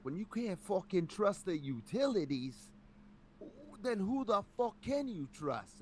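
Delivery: high, slow New York tough guy; thick New York accent, dragged out. Retold or imitated. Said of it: "a thick, like, New York accent".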